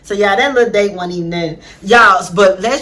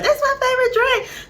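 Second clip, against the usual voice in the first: squeaky high soft gushing; mock a girly squeal of delight; lightly voiced giddy date; gushing girl; 13 semitones higher, 6 dB quieter than usual. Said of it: "She was like".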